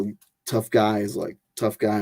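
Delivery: ordinary delivery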